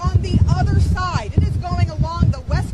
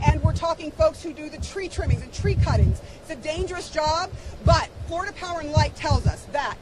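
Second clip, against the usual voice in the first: ordinary talk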